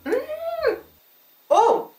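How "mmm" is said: very high soft mmm of delight; savoring delicious food. Real, not acted. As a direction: melt over the taste. Tasting chocolate.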